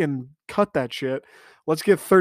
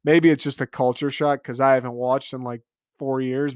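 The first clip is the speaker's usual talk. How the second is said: ordinary talk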